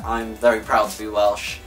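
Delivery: usual speaking voice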